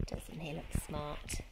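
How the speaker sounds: soft, slow whisper so the dog sleeps on; quiet whisper beside a sleeping puppy. Real, not acted. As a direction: hush it so you don't wake him. Teacher whispering about the sleepy dog.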